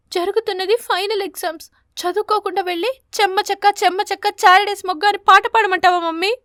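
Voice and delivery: high, rising, angry mocking question; a daughter angry about her final exams; angry sarcasm. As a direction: throw the words at her mother. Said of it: "కీర్తి పిచ్చుకకు కోపం వచ్చింది".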